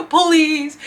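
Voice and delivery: high, tearful laughing plea; hammed-up whimper; mock pleading. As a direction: beg half-crying, played for laughs. Mock begging amid loud singers.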